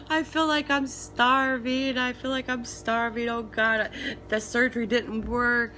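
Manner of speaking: tearful high voice; panicked and tearful; crying. Retold or imitated. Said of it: "I was calling Michael crying".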